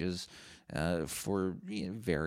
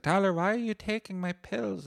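much higher, louder, upset mother voice; imitating mom; 5 dB louder than usual. Tyler imitating his mom finding pills missing, high pleading voice. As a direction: play the mother catching her son. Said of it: "She's like".

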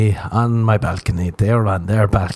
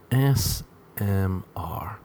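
slow breathy ASMR whisper; 10 dB quieter, much slower than usual. Shown as a demonstration.

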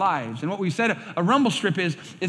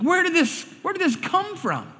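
bewildered voice; 10 semitones higher than usual. Retold or imitated.

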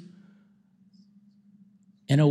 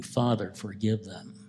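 hushed plea; 7 semitones lower, 8 dB quieter than usual. Acted. Jesus repeating the plea.

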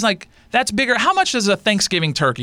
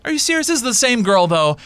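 ordinary delivery

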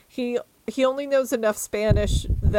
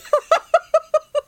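loud high laugh; 8 dB louder than usual. Real, not acted. Friend laughing loudly at a book mishap, bursting out.

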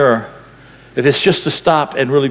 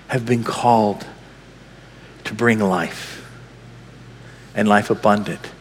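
usual speaking voice

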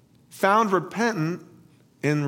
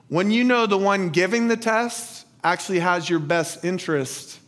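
usual speaking voice

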